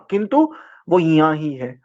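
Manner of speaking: ordinary talk, nothing marked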